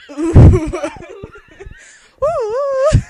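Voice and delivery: loud high laughing Ooh. Shown as a demonstration. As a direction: crack up on the Ooh. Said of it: "that's ooh".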